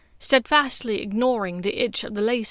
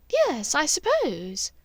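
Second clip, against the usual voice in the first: slow, a touch higher, guarded reply; hesitant Narcissa; 6 semitones higher, slower than usual. Acted. Draco disguised as Narcissa stalls, slow and wary. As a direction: drag it out carefully, unsure.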